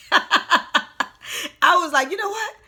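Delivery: high, laughing lead-in. Real, not acted. Cracking up at their own reason to be happy, laughing. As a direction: laugh through the words, pitch climbing. Laughing over the hair joke.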